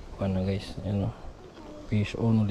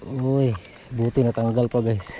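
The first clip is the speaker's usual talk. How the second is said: usual speaking voice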